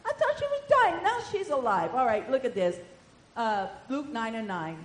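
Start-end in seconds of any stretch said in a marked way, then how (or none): 0.0-1.9 a hint of a shrill, dismayed devil; dismayed Satan. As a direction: complain like a foiled devil. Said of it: "Satan said".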